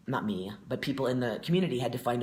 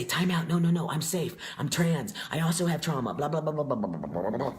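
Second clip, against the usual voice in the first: mock pleading that tumbles into babble; urgent self-justifying; 3 semitones higher than usual. The old self running up to strangers to explain, mock urgent. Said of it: "but the old me, unhealed, would wanna run up to them and say".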